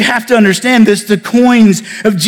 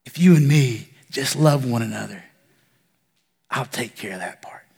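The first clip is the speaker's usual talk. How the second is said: tender whisper; 6 semitones lower, 9 dB quieter than usual. Acted.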